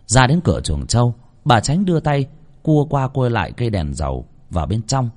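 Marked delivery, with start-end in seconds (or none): none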